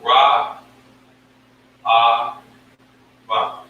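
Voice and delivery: "Ra, Ah, Ba" each syllable said alone with pauses; teacher drilling Ra Ah Ba. Shown as a demonstration. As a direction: spell it out sound by sound. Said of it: "this is how you pronounce it".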